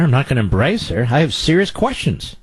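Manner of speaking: normal talking voice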